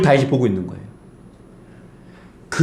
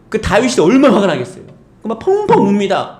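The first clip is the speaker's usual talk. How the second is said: ordinary talk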